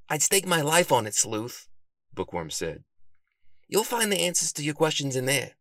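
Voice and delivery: a hint of an eager bookish friend; lightly voiced Bookworm. A narrator's reading.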